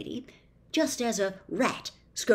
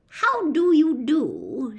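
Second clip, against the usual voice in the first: higher, louder, slow polite greeting; old lady; 7 semitones higher, 7 dB louder, slower than usual. A narrator's reading. The old lady greeting Milo, slow and genteel. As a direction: greet him graciously. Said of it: "she replied".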